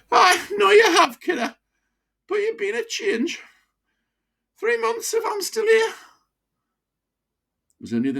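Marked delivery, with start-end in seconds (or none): 0.1-5.9 high broad Wearside, kindly; old pitman thanking his doctor gently; old Wearside miner, fond farewell. Acted. Old miner saying goodbye to his doctor, fond.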